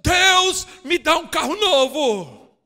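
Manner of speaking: high, loud, slow spoiled demand; mocking demand. Preacher mocking greedy prayers, with a hammed-up demand. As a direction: ham up a bossy prayer for a car. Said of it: "você tem que dizer para Deus".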